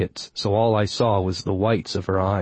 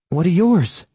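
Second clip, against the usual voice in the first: put-on curious young woman; 9 semitones higher, 5 dB louder than usual. A narrator's reading. Granuaile voiced curious.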